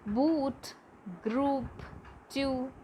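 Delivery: "Boot, group, two" with held oo; each word said singly, long "oo". Shown as a demonstration. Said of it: "that is long U sound".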